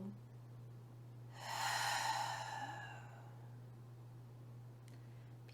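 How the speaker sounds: long open-mouthed exhale; cleansing sigh. Shown as a demonstration. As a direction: let the breath pour out slowly. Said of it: "let it go".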